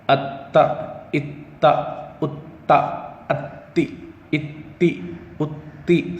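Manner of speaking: اتا and اتی with the ت stopped hard; hard doubled t. Shown as a demonstration. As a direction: clip the doubled ت sharply. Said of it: "سختی سے گھٹ پڑھنا". (سختی سے اتا)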